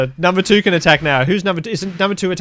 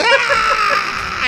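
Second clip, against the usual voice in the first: shrill creature screech; high shrieking cry. Acted. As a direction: let out a piercing high cry. Meepo's cry in combat.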